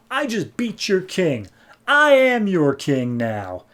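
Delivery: slow, high declaration of kingship; mock proclamation. Aquaman claims the throne before the Atlantean army, mock proclamation. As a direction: proclaim it grandly, drawing the words out.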